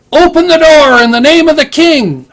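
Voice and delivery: high, loud commanding yell. Acted. Player yelling at someone behind the dungeon door, loud yell. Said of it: "or you could yell".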